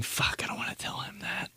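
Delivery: "I don't wanna tell him" whispered to himself; whispered aside. Acted. Friend whispering to himself about his buddy's habit. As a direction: mutter it under your breath in a whisper.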